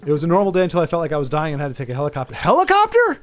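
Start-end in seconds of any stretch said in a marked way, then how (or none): 2.3-3.2 high-pitched, incredulous question; astonished. Real, not acted. Reacting to a viewer's helicopter trip, astonished. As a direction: echo it in sharp, high disbelief. Reading shocking news from chat.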